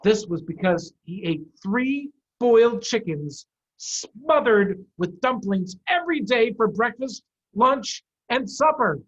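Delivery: ordinary delivery, nothing marked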